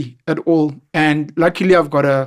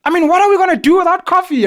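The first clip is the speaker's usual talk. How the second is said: mock fussy girl; 11 semitones higher, 5 dB louder than usual. Imitating a fussy corporate girl.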